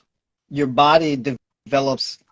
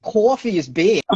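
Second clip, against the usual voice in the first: slow, higher New York cwawfee; New York accent; 8 semitones higher, 6 dB louder, slower than usual. Shown as a demonstration. Host keeps the New York accent for the guest. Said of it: "Keep on. I wanna hear more".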